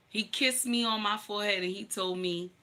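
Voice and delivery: normal talking voice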